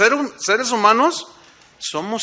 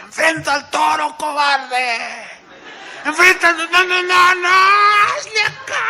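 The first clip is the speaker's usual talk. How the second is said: much higher, loud yell at the bullfighter; abuela yelling; 9 semitones higher, 5 dB louder than usual. Retold or imitated. Grandma yelling at the bullfighter to face the bull, loud and high. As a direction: scream at the torero from the stands. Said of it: "mi abuelita se paraba y decía".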